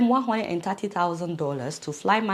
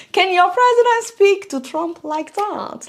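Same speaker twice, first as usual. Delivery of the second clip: higher, louder, slowed challenge; slow, pitched-up challenge to other Africans; challenging viewers; 9 semitones higher, 6 dB louder than usual. Real, not acted. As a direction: dare the viewers, slow and loud.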